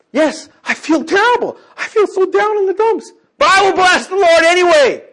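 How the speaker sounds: high, loud proclaiming; loud declaration. Shown as a demonstration. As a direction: declare it at full voice. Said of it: "here's an example of what I mean".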